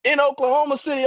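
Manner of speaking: booming Cornel West pulpit shout. Retold or imitated. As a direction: roar it like a fired-up preacher.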